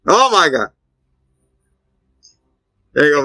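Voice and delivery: ordinary talk, nothing marked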